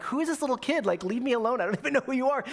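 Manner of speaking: high-pitched mock exasperation; irritated. Friend annoyed at a staring little kid, imitated irritation. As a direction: act out fed-up protest at a kid. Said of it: "She's starting to get irritated".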